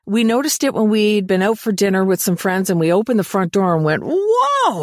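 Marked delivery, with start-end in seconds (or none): 4.1-4.8 high, sharp surprised whoa; startled whoa. Retold or imitated. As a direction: recoil at a sudden stench. Reacting to smell at door.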